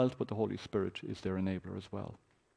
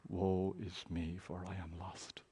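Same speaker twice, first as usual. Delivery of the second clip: softer, slow, broken confession; hushed lament; 5 semitones lower, 10 dB quieter, slower than usual. Acted. Isaiah lamenting his sin, quiet and slow. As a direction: let the cry sink almost to a murmur. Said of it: "Isaiah cries out".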